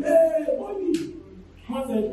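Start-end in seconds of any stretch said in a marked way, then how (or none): 0.0-0.9 mock excited shout. Retold ushers' joyful reaction.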